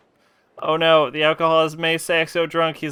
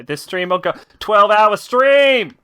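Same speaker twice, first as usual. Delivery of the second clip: hyped announcement; 5 semitones higher, 6 dB louder than usual. Real, not acted. Hyping a twelve hour stream.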